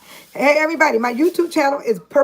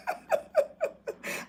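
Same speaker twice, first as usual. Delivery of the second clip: high breathy giggle; high airy giggle of delight; 10 dB quieter than usual. Real, not acted. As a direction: let a delighted giggle slip out. Excited about the Cash App.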